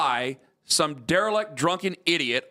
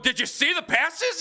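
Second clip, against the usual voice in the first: excited; 12 semitones higher than usual. Retold or imitated. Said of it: "Why are you excited?".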